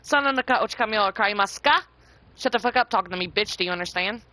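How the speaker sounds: loud mock telling-off; mock cussing out. Showing chat how they'd cuss someone out, loud mock anger. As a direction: bark it at them like a fake threat. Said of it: "I'd be over here cussing you out".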